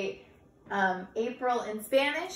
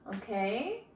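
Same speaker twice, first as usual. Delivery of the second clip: ordinary talk, nothing marked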